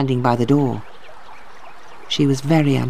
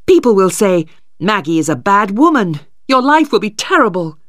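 higher, louder urgent plea; Stephen warning; 5 semitones higher, 3 dB louder than usual. Acted. Stephen warning Maggie what people will say, urgently. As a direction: press the warning hard.

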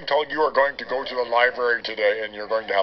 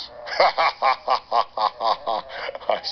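pleased chuckle. Acted. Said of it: "See what I did there?".

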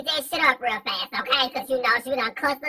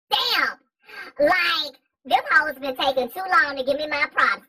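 usual speaking voice

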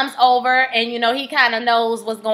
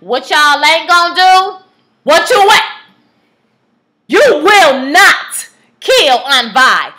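rising to a slow, much louder and higher shout; outraged shout; 3 semitones higher, 8 dB louder, slower than usual. Real, not acted. Vlogger shouting at the show not to kill Aunt Vi. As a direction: yell it at the writers, refusing to let her die.

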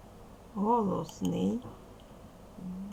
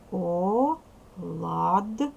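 оладки drawn out very slowly, о-лад-ки, louder; syllable split; 5 dB louder, much slower than usual. Shown as a demonstration. Teacher splitting оладки into syllables for the children. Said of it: "ділимо на склади".

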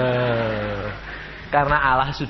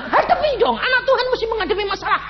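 retold urging; 3 dB louder, faster than usual. Urging his frightened crew.

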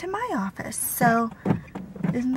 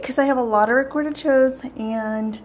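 out of breath from the stairs; 2 semitones higher than usual. Real, not acted. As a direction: talk while catching your breath. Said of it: "I got a breath, I just ran up the stairs".

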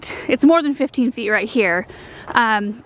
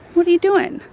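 higher, sing-song pet talk; cooing at the cat; 6 semitones higher than usual. Real, not acted. Owner coos to her cat Russell in the garden, sing-song pet talk.

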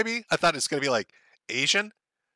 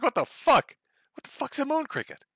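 baffled bystander; 9 semitones higher than usual. Retold or imitated. Men on the corner confused by the insult, mock bafflement. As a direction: play the confused guy on the corner. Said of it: "they're gonna be like".

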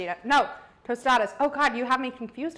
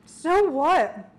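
"So what?" parroted back mockingly, a bit higher and louder; mocking imitation; 4 semitones higher, 4 dB louder than usual. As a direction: mimic "So what?" in a teasing, mocking tone. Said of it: "God, you're annoying".

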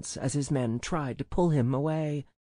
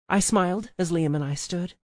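ordinary delivery, nothing marked

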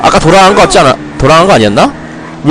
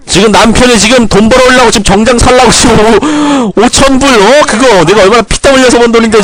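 mock shouting at wife; 7 semitones higher than usual. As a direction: play-act scolding the wife over wasted money. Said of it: "샤우팅 해야겠어".

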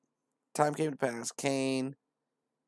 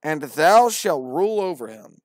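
solemn verse; 5 semitones higher, 13 dB louder than usual. Acted. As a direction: proclaim the question. Verse read out.

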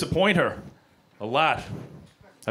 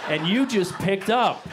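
emphatic; 5 semitones higher than usual. Real, not acted. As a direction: sell the warning with growing emphasis. Emphatic comic build.

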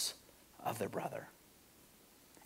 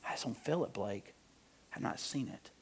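very soft, nearly fading out; barely voiced, in a subdued hush; 5 semitones lower, 13 dB quieter than usual. Preacher voicing doubt about Jesus's care, hushed.

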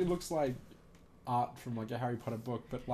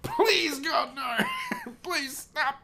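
high, laughing, pleading chair voice; laughing through a mock cry of pain; pained furniture voice; 5 dB louder, much slower than usual. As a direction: squeal like a chair crushed by a giant. Furniture voiced crying under Hagrid.

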